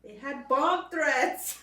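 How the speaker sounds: slow, loud, punched reveal; a slow, loud punchline; dramatic reveal. Real, not acted. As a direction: drag out the big news slowly.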